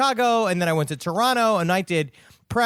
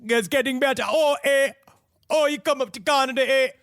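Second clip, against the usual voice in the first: hammed-up Canadian accent, much higher; 7 semitones higher than usual. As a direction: play a jokey Canadian and lean on "eh". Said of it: "is my Canadian accent getting better?".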